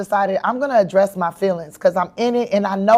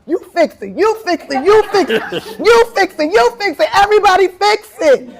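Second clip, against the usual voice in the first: climbs much higher and louder each time; building rally cry; 10 semitones higher, 4 dB louder than usual. Retold or imitated. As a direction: whip the crowd up, line by line. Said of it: "I was like".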